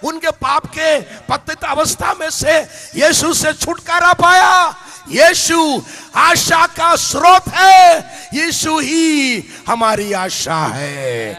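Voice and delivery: rising to loud, high shouting, slowing at the peaks; preaching at full force. Acted. Preacher proclaims hope in Jesus, building to shouts. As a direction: build until you are crying out to the crowd.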